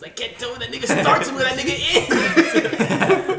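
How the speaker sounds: imitating a friend cracking up, high-pitched; laughing. Layton cracking up at the flash photo, laughing. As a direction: squeeze the words out through high, drawn-out laughter. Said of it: "just starts laughing".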